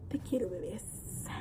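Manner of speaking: soft loving whisper; tender whisper. Real, not acted. To her unborn baby, tender whisper. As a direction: whisper it to the babies.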